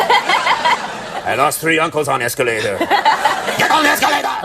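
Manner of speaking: slow, heavy mock Russian accent, laughing; hammed-up Russian accent through laughter; joking Russian accent, drawn out. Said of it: "let me imitate the Russians".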